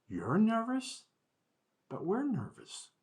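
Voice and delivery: put-on disbelieving children, pitch way up; surprised kids. Students surprised teacher is nervous.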